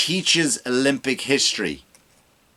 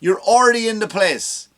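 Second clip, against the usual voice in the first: high exasperated calling out; high mock telling-off; exasperated retort; 10 semitones higher than usual. Comic mocking a supporter, high exasperated retort. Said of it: "Can't you just go".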